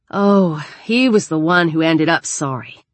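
ordinary delivery

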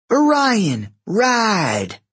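high, drawn-out command; calling a command. A narrator's reading. Hazel commands her horse to ride, calling out. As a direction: call out to spur on a horse.

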